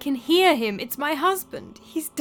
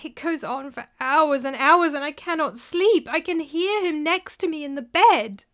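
crying out; 6 semitones higher, 5 dB louder than usual. A narrator's reading. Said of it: "cried Elisana".

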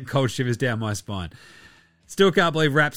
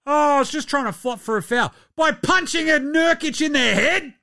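high, mocking whiny excuse; 13 semitones higher, 3 dB louder than usual. Host mocking a player's excuse, high whiny mock voice. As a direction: sneer it out like a feeble alibi, slowing on the head bit. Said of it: "What a lunatic".

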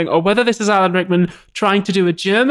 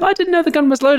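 high-pitched mocking imitation of an excuse; 8 semitones higher than usual. Host mimicking the cop who shot his partner, mocking.

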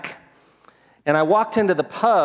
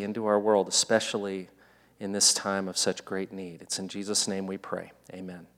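low, subdued praying voice, fading to the Amen; prayer; 5 semitones lower, 4 dB quieter than usual. Real, not acted. Pastor praying for his congregation before preaching, low reverent prayer. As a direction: pray softly and low, sinking away at the close.

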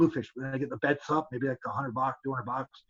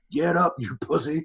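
mock Southern drawl, slow and a bit louder; Southern referee yelling; 4 semitones higher, 5 dB louder than usual. Old Southern referee yelling at a fighter sitting down, mock Southern drawl. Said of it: "'cause he talks all Southern".

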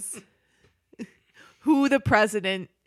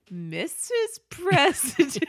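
singsong; 6 semitones higher, much slower than usual. Retold or imitated. Character calling in sing-song.

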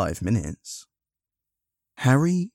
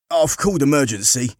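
raised, higher voice of a bystander; calling out; 6 semitones higher, 5 dB louder than usual. Acted. Man in the crowd reporting to Harry, calling out. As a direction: call it out over a crowd.

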